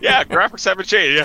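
a lightly voiced bored little boy; unimpressed kid. Child watching dad play, mock unimpressed voice.

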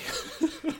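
silent wheezy laugh; breathy high giggle. Real, not acted. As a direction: let out an airless giggle. Laughs at own joke.

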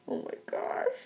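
laughing, voiceless aside; embarrassed whisper. Real, not acted. Embarrassed, breaks off telling her story.

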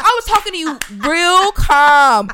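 retold heated telling-off; indignant. Retold angry complaint to driver.

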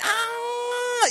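high, stalling ah; meaningless high ah. Retold or imitated. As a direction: make a noise that means nothing. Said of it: "making all those noises that mean absolutely nothing".

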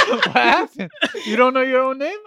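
high, loud, laughing teasing. Real, not acted. Friend mocks him for not knowing his name. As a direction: tease him through laughter.

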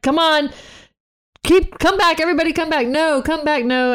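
high-pitched retold plea to come back; mock urgent calling. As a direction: call everyone back, play-acted. Acting out pleas to retreat.

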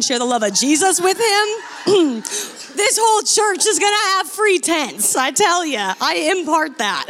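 high, exuberant declaration through laughter; mock proclamation. Promising the whole church free tents, gleeful mock preaching.

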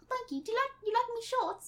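put-on soft, high British boy voice; little British boy accent. Christopher Robin greets Piglet in a little British boy accent. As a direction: answer lightly as a small English boy. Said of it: "your little British boy accent".

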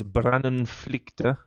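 Brandonflykte drawn out very slowly, rising at the end; Norwegian word. Shown as a demonstration. Host teaching friends a Norwegian word, slow and careful. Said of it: "which means fire escape".

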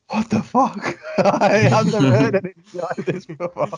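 high, stunned "what the fuck" reaction; shocked disbelief. Retold or imitated. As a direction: blurt it out in amazed disbelief. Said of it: "being like".

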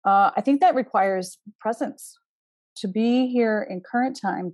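ordinary delivery

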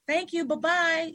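high, sing-out airline farewell; stewardess sign-off. Acted. Stewardess to passengers leaving the airplane, high mock farewell. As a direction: imitate a stewardess seeing passengers off. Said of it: "You know how the stewardess says".

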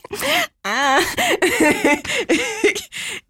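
a long high laugh. Real, not acted. Big laugh after mock quote. (ένα μακρύ, ψηλό γέλιο)

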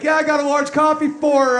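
loud, high order call; barista calling order. Retold or imitated. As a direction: call the drink out across the shop. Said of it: "he's like".

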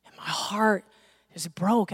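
a hushed, hurting breath; barely voiced sorrow at her answer; broken whisper. Real, not acted. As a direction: whisper it, heartbroken. Recalling heartbreaking answer.

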